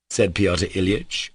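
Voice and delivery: usual speaking voice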